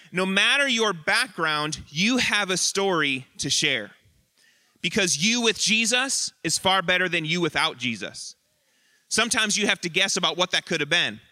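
ordinary delivery

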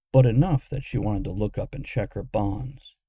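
ordinary delivery

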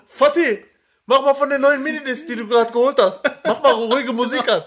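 retold calling out. Man asking the owner to play music, lightly mocked call. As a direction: imitate calling over to the owner, amused. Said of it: "schreit er so den Besitzer".

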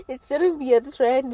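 normal talking voice

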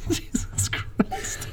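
airy high laugh into a soft slow curse; breathy disbelieving laugh. Real, not acted. Husband laughing off wife's complaint.

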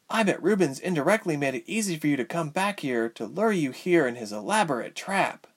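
high, mildly bitter character voice; bitter heroine. Acted. Ilse warns Tyke of the trap, high mildly bitter voice.

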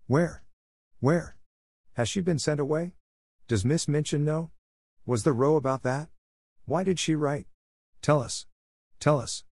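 lightly voiced excited girls; excited babble. A narrator's reading. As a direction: girls pressing for news. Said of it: "There was a perfect babel".

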